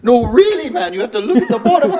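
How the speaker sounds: urgent professor, played for laughs; Jordan Peterson. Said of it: "I've been working on that impression".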